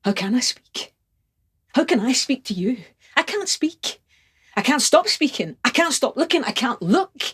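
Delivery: high, urgent outpouring; desperate passion. Acted. Lover overwhelmed before the beloved, high and frantic. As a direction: let the pitch climb as the words tumble out.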